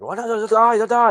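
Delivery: high, loud mock yelling of a car's GPS voice. Car GPS yelling as it drives off alone, mock robotic yell. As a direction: imitate a robotic car voice gone haywire. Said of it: "with the Korean voice starts yelling".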